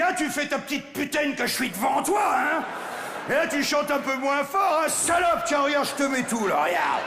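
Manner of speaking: high-pitched mock taunting shout; psyching himself up. Male spider motivating himself behind the female, taunting. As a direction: egg yourself on with crude bravado. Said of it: "il est obligé de se motiver".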